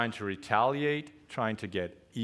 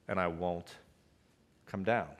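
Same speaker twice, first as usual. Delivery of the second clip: ordinary talk, nothing marked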